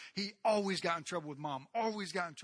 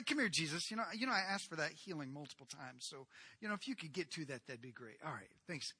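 casual demand; 3 semitones lower, 8 dB quieter than usual. Acted.

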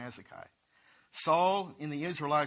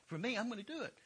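put-on higher, boastful teen bragging about the giant; cocky young David; 7 semitones higher than usual. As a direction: brag like a kid sure of his faith. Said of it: "He said".

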